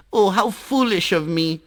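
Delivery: put-on high, sweet child voice, flattering; butter up the Sun in a high bright voice; little water drop sweet-talking the Sun, voiced high; Jal the water drop.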